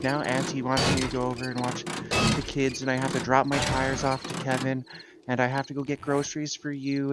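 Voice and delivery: high, rushed and frazzled; freaking out. Retold or imitated. Sister on phone listing chores, frazzled and high. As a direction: rattle off the list like it's all too much. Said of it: "freaking out".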